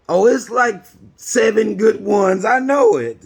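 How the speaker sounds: high, slow, prissy defending voice; mock defender. Mocks people defending cave stories.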